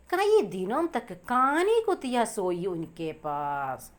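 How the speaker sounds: slow, drawn-out reading of the Hindi line; poem recitation. Shown as a demonstration. Teacher reading the next line to students, slow and measured. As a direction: read the line slowly, word by word.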